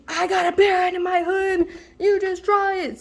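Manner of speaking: high, strained-up mock threat; defiant friend. Friend warning a cop off, in high-pitched defiance.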